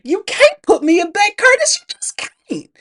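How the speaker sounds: high, desperate pleading. Retold or imitated. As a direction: beg in a high strained voice. Acted Effie pleading with Curtis.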